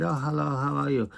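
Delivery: quick mock Chinese accent; imitating a Chinese accent in passing; lightly put-on Chinese accent, hurried. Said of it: "I met a Chinese person and he said".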